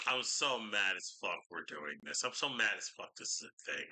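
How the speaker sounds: normal talking voice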